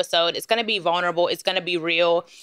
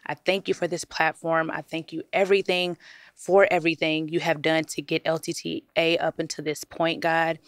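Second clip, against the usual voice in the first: low, softer prayer voice, reverent and steady; earnest prayer; 3 semitones lower, 3 dB quieter than usual. Acted. Host praying before the episode, reverent and soft. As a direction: pray sincerely over the episode.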